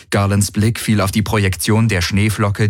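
ordinary delivery

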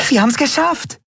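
very high, elated squeal; overjoyed high-pitched cry; joyful cheer. Acted. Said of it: "drehte vor Freude einen Looping".

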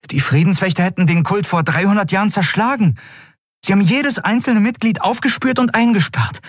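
put-on a worried boy blurting out what school taught; young boy Miko. A narrator's reading.

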